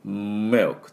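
"ミルク" as English milk with long hummed m; slow milk opening on a buzzing m. Shown as a demonstration. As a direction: hold the m, then release milk. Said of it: "音を振動させて".

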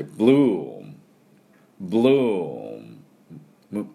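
"ブルーム" as English bloom, long oo, humming m; slow English BLOOM, no vowel after m; bloom with closed m. Shown as a demonstration. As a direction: draw out bloom and shut the lips firmly on m. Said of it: "口をしっかり閉じて".

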